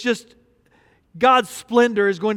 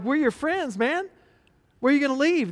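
pleading disciples; 5 semitones higher, 3 dB quieter than usual. Acted. Voicing disciples.

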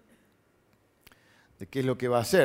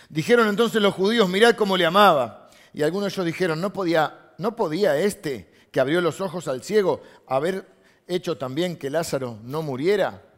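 loud, raised Bible reading; emphatic scripture reading; 4 semitones higher, 7 dB louder than usual. Acted. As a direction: proclaim the verse to the congregation.

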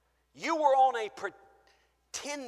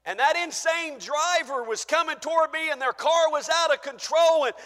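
higher, louder, breathless story; excited retelling; 10 semitones higher, 11 dB louder than usual. Acted. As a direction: tell it like it's happening now. Retelling near crash.

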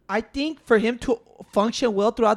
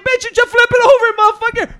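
high, loud, exasperated retort; a hammed-up fed-up yell, much higher and louder; mock outburst; 10 semitones higher, 5 dB louder than usual. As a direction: blurt it out like you can't believe it. His imagined retort, put-on voice.

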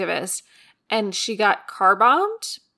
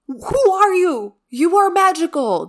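awestruck gushing; 2 semitones higher than usual. Acted. To the listener, gushing about her cello-playing neighbor with awe. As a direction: rave as if meeting a legend.

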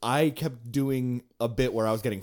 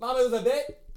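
pleading; 11 semitones higher than usual. Retold or imitated. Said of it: "And I was like".

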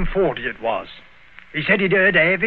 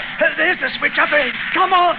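pitched-up, hurried urging; urgent; 11 semitones higher than usual. Acted. Guide calling the switch ahead while running, urgent. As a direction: spur the others on, much higher. Racing the train.

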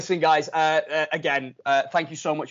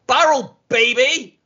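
excited shout; 10 semitones higher, 9 dB louder, slower than usual. Real, not acted. Host hyping the league to listeners, excited shout. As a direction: shout it like a hype man. Hyped podcast segment intro.

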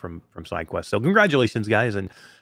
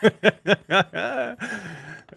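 a long bright laugh at the joke; big laugh; 8 semitones higher than usual. Real, not acted. Laughing at his cohost's old-man jab, big laugh.

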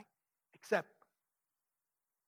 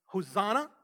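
raised, louder voice of an acclaiming crowd; mild retold crowd shout; 4 dB louder than usual. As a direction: proclaim it like a cheering crowd, held back. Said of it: "the people are shouting".

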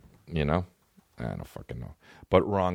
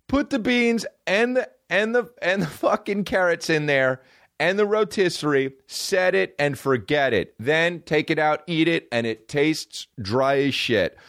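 infomercial host; 6 semitones higher, slower than usual. Retold or imitated. Infomercial cook rambling in put-on voice.

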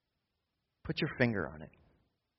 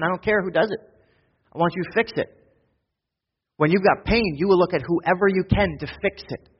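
rising louder and higher; pushing toward the pulpit peak; building preaching; 5 semitones higher, 3 dB louder than usual. Acted. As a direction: build from list to insistence.

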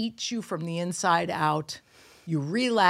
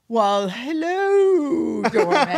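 high, drawn-out hammed-up hello; grand celebrity greeting; 6 semitones higher, 5 dB louder, much slower than usual. Deluded celebrity greeting her doorman, hammed-up grand. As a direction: play the imagined star greeting a fan.

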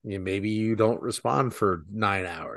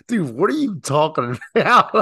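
laughing mock disbelief; 6 semitones higher, 6 dB louder than usual. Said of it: "he was like".

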